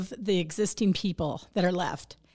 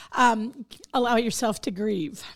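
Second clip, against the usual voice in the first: ordinary delivery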